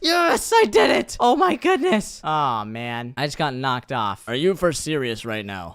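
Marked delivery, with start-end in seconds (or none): 0.6-2.2 high, loud cry of relief; real elated amazement; triumphant shout. Real, not acted. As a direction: burst out after landing the jump. Celebrates making the jump.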